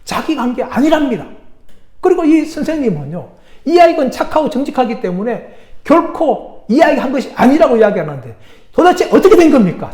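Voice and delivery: high-pitched, incredulous complaint; exasperated pastor. Acted. Pastor complains to the head elder, exasperated. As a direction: report it in acted exasperation, pitch up. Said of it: "정말 목사님 가슴을 쳤어요".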